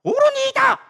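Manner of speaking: high, loud, held-out cry; defiant biblical outburst; angry retort. Acted. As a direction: act out an indignant answer at a high pitch. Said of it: "성내어 죽기까지 할지라도".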